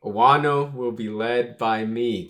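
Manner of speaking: loud, slow, stately declaration; booming public vow, deliberately paced; proclamation. Acted. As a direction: declare it to a whole crowd, drawn out. Reading the hero's public proclamation.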